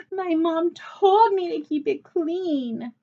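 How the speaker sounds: high, slow tearful whine; whimpering. A narrator's reading. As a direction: cry it out to the teacher. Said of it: "Chloe wailed".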